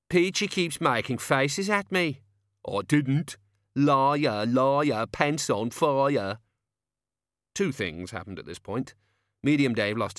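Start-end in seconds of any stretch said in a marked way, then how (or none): 0.1-6.4 bickering like schoolkids; whiny kid voice; childish whining squabble. Acted.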